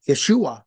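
"Yeshua" said as ye-SHU-a, the middle syllable leaning hardest; stress on second syllable. Shown as a demonstration. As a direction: hit the SHU in "Yeshua" and let it fall. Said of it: "the emphasis on the second syllable".

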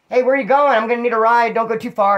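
high, urgent imitation of calling out; calling after someone. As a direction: holler after a friend who is leaving you. Calls after a fleeing friend.

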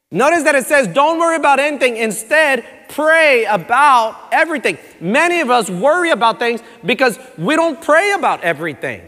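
loud, high-pitched preaching; fervent preacher proclaiming scripture. Acted. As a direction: drive the verse home from the pulpit. Preaching at full force.

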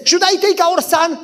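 high-pitched mock worry; anxious. Wife asking husband before visiting neighbors, anxious. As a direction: imitate a worried wife, voice up high.

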